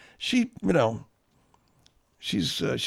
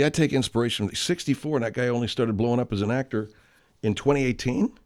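ordinary talk

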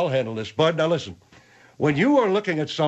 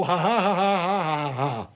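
imitated singsong laugh. General holding his temper, singsong imitation. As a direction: run the mock laugh on and on. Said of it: "come out like a song".

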